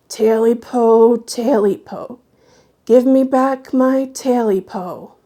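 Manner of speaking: a hint of a low, menacing creature. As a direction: creature on the bed demands its tail, low and menacing. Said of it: "in a real low voice".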